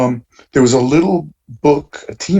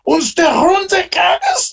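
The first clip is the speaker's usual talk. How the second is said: high, exaggerated fake German rant, much higher than his own voice; mock German gibberish; 13 semitones higher than usual. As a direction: spout nonsense German in a shrill caricature voice. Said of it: "suddenly I hear this loud German voice".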